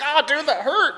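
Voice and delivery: high, lightly put-on kid voice; little girl. Little girl to the snowboarder, high mock-hurt voice. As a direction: imitate a kid complaining. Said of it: "She's a little girl".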